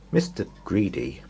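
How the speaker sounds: usual speaking voice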